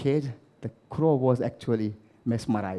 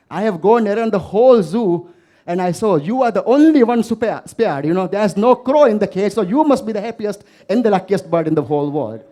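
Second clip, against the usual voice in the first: peacock's loud lament; 5 semitones higher, 7 dB louder than usual. Acted. Caged peacock complains to crow.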